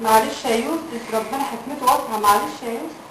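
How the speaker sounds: soft consoling. Acted. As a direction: soothe him gently, almost a murmur. Wife consoles husband softly.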